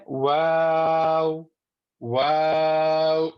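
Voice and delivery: "واو" drawn out long on a level note; slow, sustained Arabic "واو"; rounded-lip waw. Shown as a demonstration. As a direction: round both lips and stretch "واو". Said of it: "دونوں ہونٹوں کو گول کر لیجیے".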